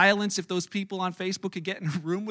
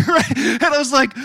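ordinary delivery

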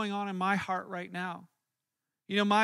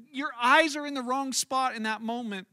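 usual speaking voice

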